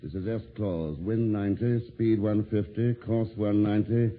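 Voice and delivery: slow, low, measured monotone; radio log readout. Acted. Detective reading Claus's last transmission, slow measured readout. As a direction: space out each call and figure.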